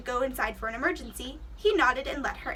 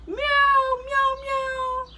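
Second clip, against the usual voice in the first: high, much louder cat calls; loud meowing; 12 semitones higher, 8 dB louder, much slower than usual. Acted. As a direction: meow as loudly as you can, way up high. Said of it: "Everyone meowed as loudly as they could".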